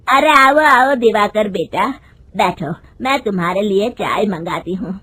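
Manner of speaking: a hint of a bossy elderly matron; mother-in-law greets a guest, then calls out loud for tea. Mother-in-law welcomes, calls to bahu.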